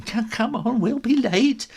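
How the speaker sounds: hurried mum. Retold or imitated. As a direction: rush them out the door. Mum hurrying out.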